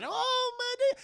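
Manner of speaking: very high mock-frightened cry; scared wail. Aunt Hattie as the TV comes on, high wail. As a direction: squeal it like a spooked aunt.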